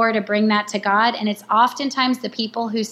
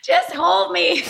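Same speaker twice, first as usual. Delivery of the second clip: laughing plea; 7 semitones higher, slower than usual. Retold or imitated.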